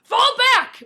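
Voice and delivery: high, loud barked command; soldier's shouted order; terse order. A narrator's reading. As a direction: order the squad back sharply. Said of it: "Brennan ordered tersely".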